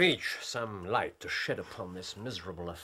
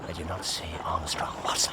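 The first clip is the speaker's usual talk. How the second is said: hushed whisper while hiding; low secret whisper; 7 semitones lower than usual. Acted. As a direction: whisper so nobody hears. Hiding under the bridge.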